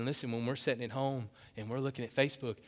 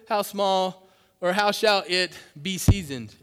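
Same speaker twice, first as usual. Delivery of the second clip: loud, raised scripture proclamation; impassioned preaching; 5 semitones higher, 9 dB louder than usual. Acted. Preacher telling congregation who the salt is, impassioned. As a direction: ring the verse out to the room.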